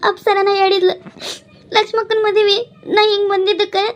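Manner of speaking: tearful, distressed report; crying. Acted. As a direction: tell the bad news through sobs. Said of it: "ಅಳಾಕತ್ತಿ".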